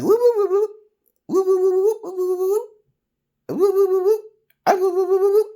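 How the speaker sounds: loud high whoop whoop whoop; high loud repeated whoops; whooping noise. Retold or imitated. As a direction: yelp it out like a siren, high and loud. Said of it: "I would be like".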